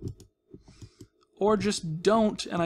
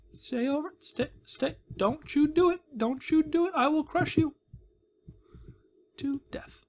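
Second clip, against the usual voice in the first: high mock-sweet menace; sweet threat; 9 semitones higher than usual. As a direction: threaten it in a cooing voice.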